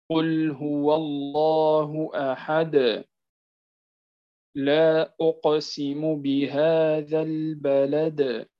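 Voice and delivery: slow chanted recitation in a different voice; Quranic recitation. Shown as a demonstration. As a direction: recite slowly, bouncing the final د. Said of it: "مثال قوله تعالى". (ترتيل بطيء مع قلقلة الدال)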